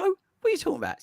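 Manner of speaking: quick, high mock outrage; fast high disbelief. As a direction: rattle it off in disbelief.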